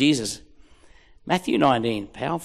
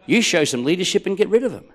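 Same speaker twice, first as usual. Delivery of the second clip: ordinary delivery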